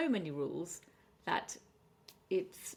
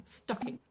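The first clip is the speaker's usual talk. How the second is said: "stocking" with a higher first syllable; first-syllable stress; 4 semitones higher than usual. Shown as a demonstration. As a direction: lean on STOCK in "stocking", let -ing drop. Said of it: "stress on the first syllable".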